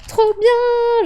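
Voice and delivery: very high and loud burst of delight; gleeful cheer. Retold or imitated. To herself at a great draw, a high, loud gleeful cry. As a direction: shout it out, thrilled with the draw. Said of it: "j'étais en mode".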